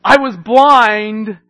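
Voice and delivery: slow, loud testimony; triumphant shout. Acted. Blind man testifying to the leaders, slow triumphant shout. Climax of the story.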